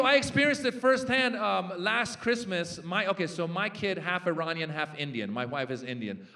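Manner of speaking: usual speaking voice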